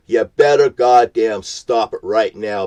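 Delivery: ordinary delivery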